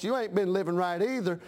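normal talking voice

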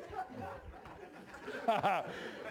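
long airy chuckle; soft laugh. Real, not acted. Preacher chuckling to the congregation, long and airy. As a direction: let the chuckle roll on.

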